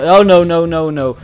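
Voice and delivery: very loud burst of dismay; alarmed shout. Real, not acted. Player attacked in game, alarmed shout.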